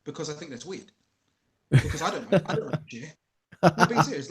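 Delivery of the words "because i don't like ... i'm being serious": much louder, emphatic; loud insistence. Real, not acted. Dad insisting he hates sharing, loud. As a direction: declare it with full force. Laughing refusal to share.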